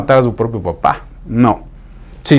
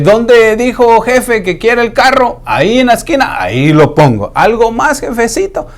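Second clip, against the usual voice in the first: high, eager obliging worker; eager servant voice; 10 semitones higher than usual. Retold or imitated. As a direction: sound keen to please the boss.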